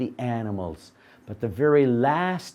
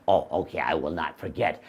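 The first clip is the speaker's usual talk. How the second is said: dopey donkey; 10 semitones lower, 4 dB quieter, faster than usual. Acted. Donkey agreeing.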